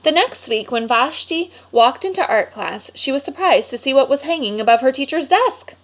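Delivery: lively storybook read-aloud voice; animated picture-book narration; reading to children. A narrator's reading. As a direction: read it like story time for a class.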